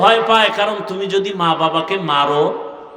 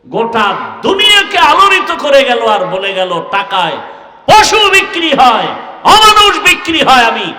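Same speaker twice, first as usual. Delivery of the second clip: very loud, high impassioned shouting; roaring sermon climax; full-force preaching; 6 semitones higher, 10 dB louder than usual. Acted. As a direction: cry out a defiant declaration to the crowd. Preaching, shouted martyr quote.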